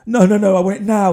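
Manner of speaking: loud, insistent imitation of an angry woman; imitating an angry woman demanding it right now; nasty demand. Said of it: "really nasty she got".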